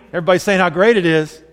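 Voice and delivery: ordinary delivery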